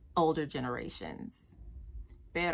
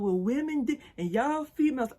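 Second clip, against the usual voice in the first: higher, louder mocking whine; mocking men's complaint; 6 semitones higher, 5 dB louder than usual. Mocking men who blame women, higher and louder. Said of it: "don't be over here talking about".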